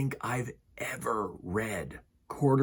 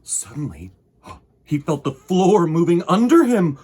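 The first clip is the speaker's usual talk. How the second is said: story narration; 5 dB louder than usual. A narrator's reading. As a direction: read to children, unhurried. Reading picture book aloud.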